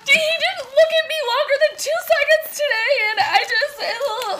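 high, teary mock complaining; whiny hurt voice. Mimics her own upset self.

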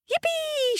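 squealing high yippee of joy; a little pig's thrilled high squeal; excited shout. Acted. Big Pig cheering at the field of straw, squealing high. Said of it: "shouted Big Pig".

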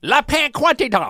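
slow, high mock French; hammy French pronunciation; fake French accent. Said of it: "you did not say it in a French accent".

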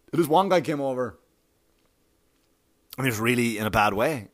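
ordinary delivery

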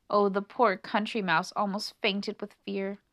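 usual speaking voice

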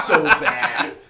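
laughing out loud; big laugh. Real, not acted. Laughing at own joke.

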